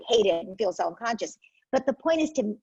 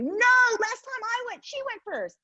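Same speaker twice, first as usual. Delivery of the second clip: put-on high, slow childish protest; whiny kid. Child arguing over who goes first, high whiny voice. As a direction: play the sibling insisting it's her turn.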